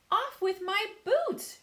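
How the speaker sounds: high, loud, slow centipede shout. A narrator's reading. Centipede shouting to get his boots off, loud. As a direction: yell it in a panic. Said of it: "shouted the centipede".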